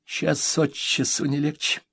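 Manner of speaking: mild mock despair. Mother leafing her diary, mildly despairing. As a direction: sigh it out, overwhelmed. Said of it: "в отчаянии сказала она".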